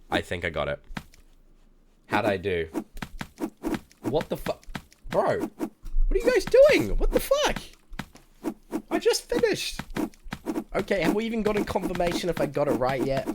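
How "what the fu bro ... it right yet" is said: high, loud, indignant screech; outraged shriek. Real, not acted. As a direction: screech your protest at chat. Outraged shriek at teasing chat.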